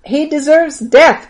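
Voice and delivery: high, loud, slow cry of condemnation; crowd's outcry. A narrator's reading. Rabbis answering the high priest, crying out their verdict. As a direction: call for death as a mob would, drawn out. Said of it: "cried the rabbis".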